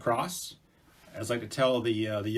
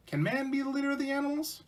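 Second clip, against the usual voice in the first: high-pitched little mouse asking; Mouse's voice; 14 semitones higher than usual. Acted. Mouse making a suggestion to the creator, high-voiced.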